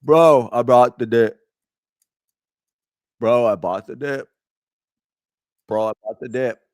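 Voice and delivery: lightly voiced bragging bro; a hint of a crypto bro boasting. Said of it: "Do I sound like a crypto bro?".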